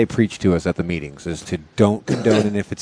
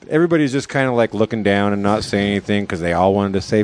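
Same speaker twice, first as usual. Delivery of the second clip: normal talking voice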